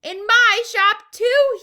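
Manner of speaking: very high, loud, slow drawn-out yell. A narrator's reading. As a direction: bellow with pride in the shop. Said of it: "he yelled".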